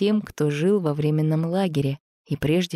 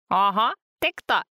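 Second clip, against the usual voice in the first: piglet voice; 5 semitones higher than usual. A narrator's reading. Zina's piglet voice.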